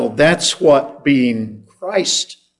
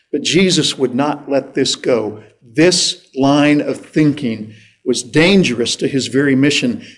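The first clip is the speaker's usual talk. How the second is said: normal talking voice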